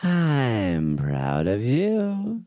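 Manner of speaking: slow, low processed voice; slowed robotic voice. Pretend. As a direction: drag it out through a voice changer. Voice effect toy.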